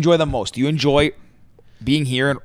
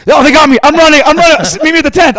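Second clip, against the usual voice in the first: high, loud mock panic; all-caps shouting; 12 semitones higher, 9 dB louder, faster than usual. Reading friend's panicked texts from festival, all-caps yelling. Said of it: "All caps".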